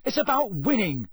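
high, restrained shout. A narrator's reading. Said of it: "shouted Ron".

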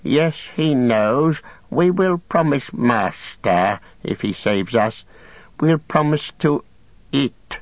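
high, slow wheedling creature voice; Gollum, wheedling. Acted. Captive bargaining to be saved, high wheedling whine. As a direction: bargain eagerly with your master.

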